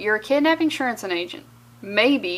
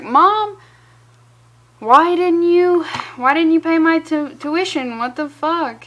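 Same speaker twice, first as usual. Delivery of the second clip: higher, louder whiny griping; whiny son; 5 semitones higher, 6 dB louder than usual. Retold or imitated. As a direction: gripe at Mom like a bratty kid. Son griping at his mom.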